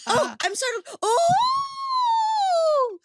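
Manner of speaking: very high whooping startled squeal; roller coaster squeal. Shown as a demonstration. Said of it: "there's an energy of startled can just go to, like, I'm on a roller coaster".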